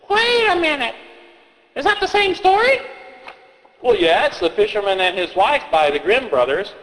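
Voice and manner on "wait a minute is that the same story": put-on high kid voice, suspicious; a kid catching a contradiction; puzzled child. Child questioning the storyteller in a high kid voice.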